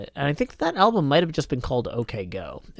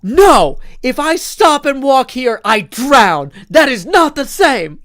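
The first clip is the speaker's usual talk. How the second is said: exasperated shouting; 11 semitones higher, 11 dB louder, slower than usual. Retold or imitated. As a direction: imitate an outraged runner, loud and high. Acts same mad runner arguing back.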